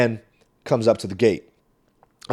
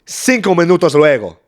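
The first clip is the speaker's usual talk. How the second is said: higher and louder, Cinco punched; dramatic Spanish; 5 semitones higher, 8 dB louder than usual. Acted. Comedian correcting his Spanish time skip, dramatic. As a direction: announce the time jump grandly.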